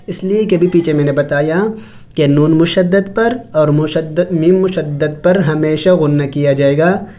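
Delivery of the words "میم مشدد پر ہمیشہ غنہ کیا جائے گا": ordinary delivery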